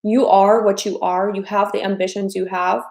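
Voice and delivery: ordinary talk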